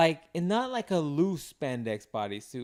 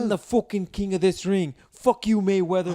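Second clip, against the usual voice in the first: higher, louder hammed-up fighter's taunt; mock trash talk; 4 semitones higher, 5 dB louder than usual. Fighter cursing his beaten opponent, hammed-up loud boast. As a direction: crow it with a mock brogue.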